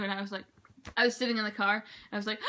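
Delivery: ordinary talk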